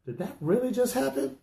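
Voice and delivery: high, stunned disbelief. Retold or imitated. Sports fan reacting to something crazy, stunned high disbelief. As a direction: ask it like you can't believe your eyes. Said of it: "makes you sit back and go".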